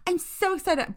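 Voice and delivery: high, empty imitation of enthusiasm; mock bland excitement. Mocking people who overuse the phrase, fake excitement. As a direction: mimic a rote, insincere gush. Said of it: "bland, like, monotone voice".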